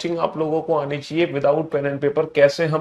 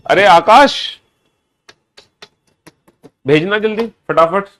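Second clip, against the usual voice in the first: loud, higher-pitched call across the room; 4 semitones higher, 7 dB louder than usual. Real, not acted. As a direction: call urgently to a helper off mic. Calls a helper off camera. (ऊँची आवाज़ में पुकारते हुए)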